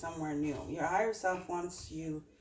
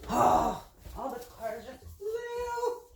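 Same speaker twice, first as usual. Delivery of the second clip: sudden high yelp of surprise as the cards fly; startled cry; 12 semitones higher, slower than usual. Real, not acted. Reader yelps as her tarot cards scatter, startled high cry. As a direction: cry out as the deck spills.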